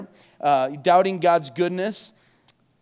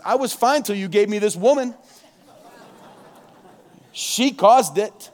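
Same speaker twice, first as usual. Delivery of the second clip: louder, higher defensive excuse; Adam blaming; 4 semitones higher, 5 dB louder than usual. Retold or imitated. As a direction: pin it on her, defensive and raised. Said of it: "Adam's first response is".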